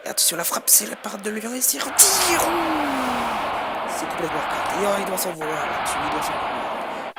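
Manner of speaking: loud rising shot call; excited sports commentary. Real, not acted. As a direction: gasp at the missed chance. Near miss, commentator. (commentaire sportif survolté)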